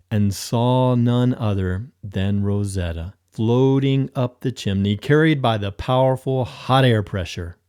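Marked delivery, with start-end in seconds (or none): none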